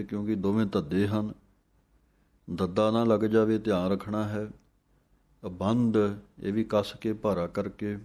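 ordinary talk

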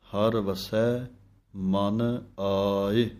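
ਹਰ ਵਸੈ and ਮਨ ਆਏ said very slowly as separate units; slow pure path; phrased recitation. Shown as a demonstration. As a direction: separate the words carefully. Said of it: "ਸੁੱਧ ਪਾਠ ਇਸੇ ਢੰਗ ਨਾਲ".

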